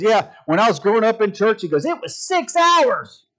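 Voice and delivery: imitating a comedian, loud and high; comedian bit. Preacher retelling a comedian's church joke, exclaiming. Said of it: "the comedian I was watching".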